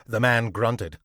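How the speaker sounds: ordinary delivery, nothing marked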